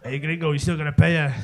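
low mock Mexican pimp accent. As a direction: demand payment in a put-on Mexican accent. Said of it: "Kind of the Mexican thing".

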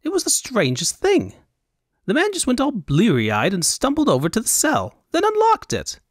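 high, airy Elvunian fop; high Elvunian accent. Acted. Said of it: "high Elvunian accent".

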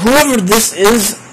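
high, slow, mock-menacing warning; mock threat. Streamer warning an anonymous viewer, mock menace drawn out. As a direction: lightly play the avenger.

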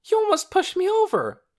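very high, loud protest; indignant cry. A narrator's reading. Lena nearly falls from Torin's shove, indignant cry. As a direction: cry out in outrage. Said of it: "cried Lena".

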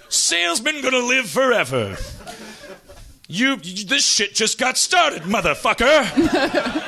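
very high, hammed-up movie tough guy; Sam Jackson. Movie star confronts the salesman, high mock swagger. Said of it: "Sam Jackson's in his kitchen".

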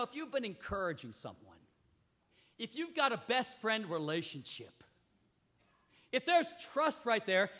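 usual speaking voice

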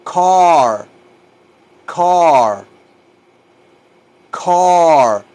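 CAR repeated, each one long and rounded; drawn-out "car", one at a time; model pronunciation. Shown as a demonstration. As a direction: drill "car" slowly for learners to copy. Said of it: "Díganlo así como lo digo yo".

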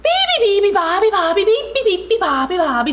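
high, cheerful birdsong chirping; cheerful baby bird. A narrator's reading. Baby bird sings in the morning, cheerful chirping. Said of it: "said the baby Beeby Bird cheerfully".

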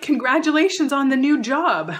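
high, bright praise with a snide question; mock cheerful jab at a coworker; backhanded congratulations. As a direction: sweeten the words, then needle. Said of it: "they can say something like".

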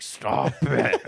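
mock whiny protest, laughing; hammed-up little cousin cry; imitating little cousin. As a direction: imitate an embarrassed kid objecting. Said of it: "and he's like".

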